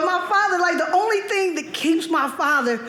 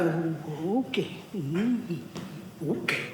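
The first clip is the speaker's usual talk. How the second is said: soft, wary ooh and okay; hushed uneasy dad; 12 semitones lower, 10 dB quieter, much slower than usual. Retold or imitated. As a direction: back off quietly, uneasy at the lit tunnel. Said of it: "he's like".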